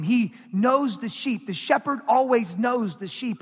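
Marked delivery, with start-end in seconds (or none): none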